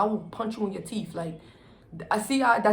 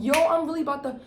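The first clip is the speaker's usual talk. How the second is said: retold threat; 8 semitones higher, 5 dB louder than usual. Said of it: "So I'm like".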